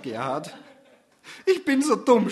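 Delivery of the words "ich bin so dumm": higher, louder mock sob; sobbing. Susanne calling herself stupid, sobbing higher. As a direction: wail it through tears. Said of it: "schluchzt Susanne".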